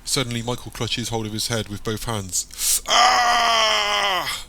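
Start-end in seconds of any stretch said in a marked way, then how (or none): 2.9-4.4 long, high agonized scream; scream of pain. A narrator's reading. Michael clutches his head, screaming in pain. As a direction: scream out loud in sudden agony. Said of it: "Michael screams out in pain".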